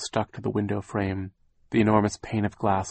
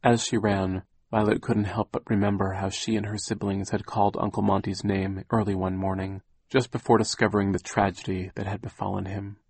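ordinary delivery

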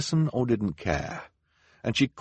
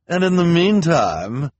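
Luciana announces; 11 semitones higher, 9 dB louder than usual. A narrator's reading. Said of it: "Officer Luciana announced".

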